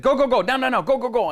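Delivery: barking go go go, high and loud; shouted orders. Retold or imitated. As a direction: yell like armed men.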